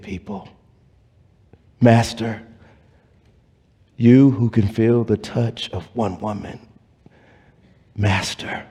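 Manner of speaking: hushed preaching. Acted. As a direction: draw them in with a reverent near-whisper.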